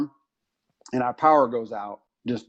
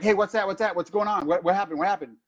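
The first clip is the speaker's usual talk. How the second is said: higher, startled rapid questions; panicked guest; 6 semitones higher, faster than usual. Retold or imitated. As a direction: act out a jumpy guest hearing the alarm. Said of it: "jump up in panic".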